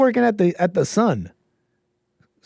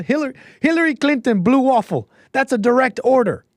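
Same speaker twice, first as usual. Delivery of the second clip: higher-pitched attempt at a Russian Putin voice, barely Russian; failed Russian accent; 10 semitones higher than usual. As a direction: bark orders like Putin in a bad Russian accent. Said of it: "that's probably not a Russian accent".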